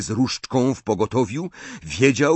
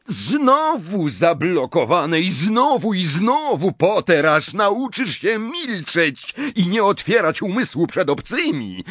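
jeering; 7 semitones higher than usual. A narrator's reading. Snape mocking Potter's blocked spells, jeering. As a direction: scoff at a failed opponent. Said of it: "zadrwił Snape".

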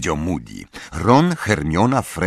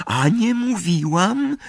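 slow, high smug lilt, rising at the end; touch of smugness; slower than usual. A narrator's reading. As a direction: draw it out, pleased with yourself. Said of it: "z nutką samozadowolenia w głosie". (z nutką samozadowolenia, wysoko i powoli)

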